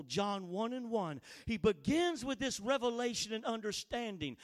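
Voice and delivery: normal talking voice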